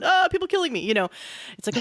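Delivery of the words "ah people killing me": high-pitched imitated dismay; mock panic. Anxious client answering coach's question, mock panic. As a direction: cry out in exaggerated, high worry.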